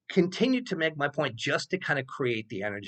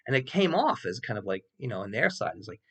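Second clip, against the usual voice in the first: normal talking voice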